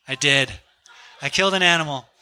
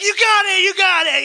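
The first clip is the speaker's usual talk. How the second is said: very high, loud excited yell; imitated excitement; 14 semitones higher, 6 dB louder than usual. Friend cheers as he shoots the antelope, imitated excitement. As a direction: mimic a friend cheering the successful shot. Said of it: "he was so excited".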